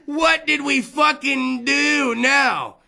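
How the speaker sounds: ordinary delivery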